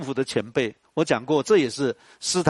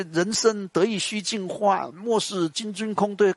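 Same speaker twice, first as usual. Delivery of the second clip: slow, higher recital of the verse; reciting Li Bai; 6 semitones higher, slower than usual. Retold or imitated. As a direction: savour the line as if reciting a poem. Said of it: "告诉我说". (稍高而缓慢地吟诵李白诗句)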